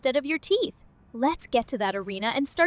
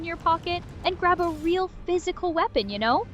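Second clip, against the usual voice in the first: put-on folksy Wisconsin accent with sing-song lilt. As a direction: play it as a chipper Wisconsin local. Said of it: "You need to be a noper from Wisconsin".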